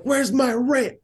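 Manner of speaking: put-on high squeaky kid demanding rent; gruff impatient scolding. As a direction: scold like a tiny angry landlord. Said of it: "And she's like".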